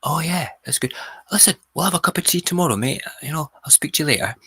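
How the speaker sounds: high, quick, casual patter; breezily put-on neighbour's voice, pitched high; imitating chatty neighbour. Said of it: "And he was like".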